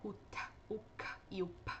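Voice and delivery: breathy unvoiced t, k and p sounds; whispered consonants. Shown as a demonstration. Teacher whispering the stop sounds for students, breathy. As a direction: whisper o t, o k e o p as bare puffs of air. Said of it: "ou seja".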